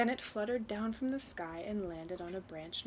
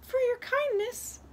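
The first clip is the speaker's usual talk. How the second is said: high, loud little wren voice; little talking bird; 6 dB louder than usual. Acted. Wren rewards the girl who freed it, high bird voice. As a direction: speak up as a tiny grateful bird. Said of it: "the bird said".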